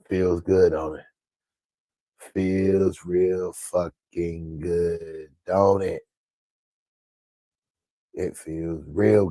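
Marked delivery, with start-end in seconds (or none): 2.3-6.0 slow, stretched-out relish; savouring it. Real, not acted. Vlogger savouring how good it feels, slow relish. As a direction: draw every word out slowly.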